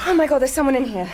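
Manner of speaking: startled. Acted. Catching a spy in the chapel, startled outcry. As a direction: blurt it out in a fright.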